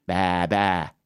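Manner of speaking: low bleating ram. Acted. Consultant voicing the ram, low bleat. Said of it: "Tule, pässi!".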